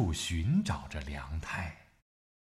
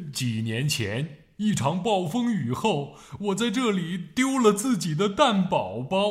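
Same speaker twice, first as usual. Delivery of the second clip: sad; 7 semitones higher than usual. A narrator's reading. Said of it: "很伤心地说".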